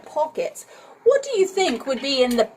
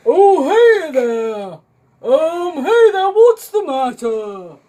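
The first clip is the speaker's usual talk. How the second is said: loud, high call up the tree; shouted; 4 semitones higher, 6 dB louder than usual. A narrator's reading. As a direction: call out loudly from below. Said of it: "shouted the moon face person".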